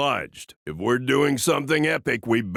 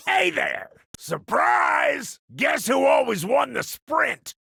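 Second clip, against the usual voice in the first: gleeful surprise shout; 11 semitones higher than usual. Acted. Aging cyborg springs a surprise on the enemy, shouting. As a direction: pop out yelling at the enemy. Cyborg fighter bursting onto the battlefield.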